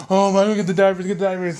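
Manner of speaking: loud, high panicked yelling about diapers; mock panic. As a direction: shout it like a panicking new dad. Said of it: "he was freaking out".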